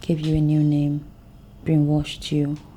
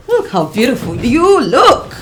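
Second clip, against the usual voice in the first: delighted; 7 semitones higher, 10 dB louder than usual. Acted. Mother admiring daughter.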